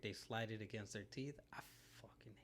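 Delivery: low disgusted whisper. Real, not acted. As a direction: whisper it with a shudder. Disgusted about fork scraping.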